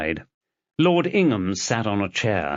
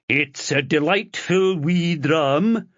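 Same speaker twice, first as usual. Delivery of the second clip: a slow, pathetic put-on Scottish brogue; hammed-up fake Scottish, savouring the dram; bad Scottish accent; 7 semitones higher, slower than usual. A narrator's reading. Ingham thanking for whiskey in a pathetic Scottish accent. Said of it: "Pathetic Scottish accent".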